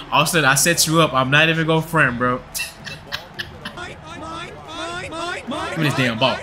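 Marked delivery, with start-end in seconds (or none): 2.5-5.6 drawn-out quiet laughter; long laugh. Real, not acted. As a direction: laugh on and on, softly. Laughing during the game.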